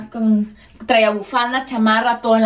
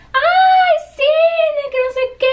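high, drawn-out excited squeal; screaming; 3 dB louder, slower than usual. Retold or imitated. Friend spots her at the airport, imitated high excited scream. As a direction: shriek like a thrilled friend.

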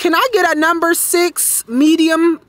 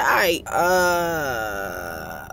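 drawn-out sigh; 13 semitones lower, 7 dB quieter, much slower than usual. Retold or imitated. Resigned reaction in retold scene.

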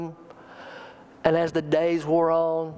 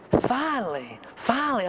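joyful relief; 6 semitones higher than usual. Retold or imitated. Wife relieved after months praying.

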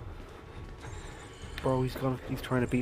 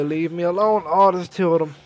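ordinary talk

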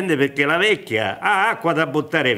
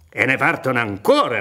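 ordinary talk

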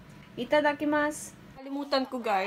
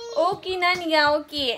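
imitating the kids; 4 semitones higher, 4 dB louder than usual. Vlogger mimics the kids asking kuya why he cries, loud whine. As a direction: ham up a kid pestering his big brother. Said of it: "Ang daming demand ng mga batang to".

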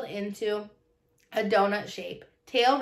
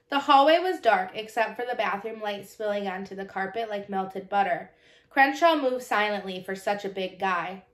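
usual speaking voice

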